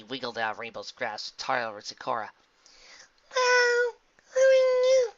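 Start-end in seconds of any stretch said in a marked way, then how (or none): 3.3-5.1 put-on very high, loud child voice; curious little filly. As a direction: ask like a tiny child. Little filly questions stranger.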